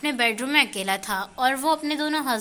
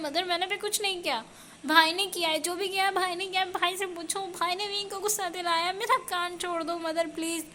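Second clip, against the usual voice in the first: higher, softer pleading in pain; whiny pained plea; 6 semitones higher, 3 dB quieter than usual. Acted. Wang Ji begs mom to release his ear, higher whiny pained plea. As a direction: beg her to let go of your ear. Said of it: "गुस्से में और दर्द के मारे".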